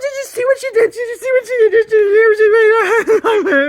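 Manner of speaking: very high, loud yapping gabble; hammed-up shrill dog outburst; frantic little dog. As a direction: let loose like a tiny dog going off. Frantic voice imitating the small dog's outburst.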